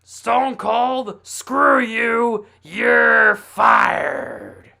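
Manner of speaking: hammed-up wrestling-boss shout; Vince McMahon impression. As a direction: roar the firing like a furious promoter. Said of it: "Do the Vince one".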